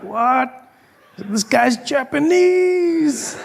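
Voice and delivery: mock thrilled realization; excited. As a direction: blurt it out thrilled. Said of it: "was super excited".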